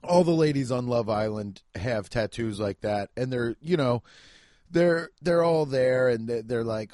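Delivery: ordinary talk, nothing marked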